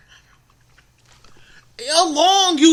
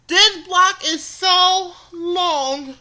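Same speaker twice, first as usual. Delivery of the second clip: exasperated complaint; 5 semitones higher, 5 dB louder, much slower than usual. Retold or imitated. Exhausted on endless walk.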